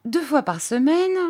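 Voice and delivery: put-on high, formal old-lady figure; formal, rule-setting aunt. A narrator's reading. Miss Clarissa lays down rules.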